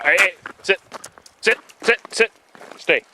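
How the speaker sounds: sharp high sit commands; firm dog commands. Real, not acted.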